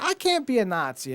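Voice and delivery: high-pitched mock denial; Shirley.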